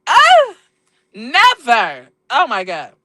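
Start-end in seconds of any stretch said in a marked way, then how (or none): 0.1-0.6 high, loud ugh of frustration; exasperated groan. Real, not acted. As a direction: blurt out a fed-up high groan. Fed up with the phone.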